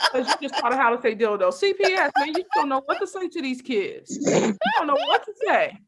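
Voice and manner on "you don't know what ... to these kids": ordinary talk, nothing marked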